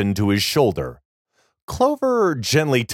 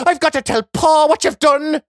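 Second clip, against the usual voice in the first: put-on high, panicked boy; frightened boy threatening; 4 dB louder than usual. A narrator's reading. Boy fleeing to tell Pa.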